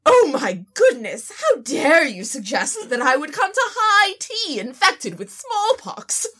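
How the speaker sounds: high, indignant British tea-party voice; offended fancy British. Pretend. Dinosaur offended by the smallpox question, in hammed-up British. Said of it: "talk like a fancy British dinosaur".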